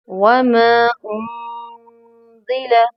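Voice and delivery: वमा उनजिला recited very slowly with the weight on वमा; वमा उनजिला slow and measured; slow recitation. Shown as a demonstration. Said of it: "recitation demo".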